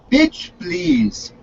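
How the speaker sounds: ऊँची, बदली हुई आवाज़ में पुतिन की नकल; ताना मारते हुए पुतिन की ऊँची नकल; mock Putin. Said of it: "looks at him and goes".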